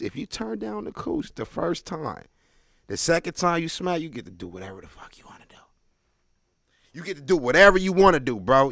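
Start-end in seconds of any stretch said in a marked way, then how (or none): 4.0-5.6 hushed punchline. Acted. Punchline dropped to a hush. 6.9-8.7 loud and emphatic, hammering it home; shouted punchline. Acted. Comic to the crowd, shouting the payoff.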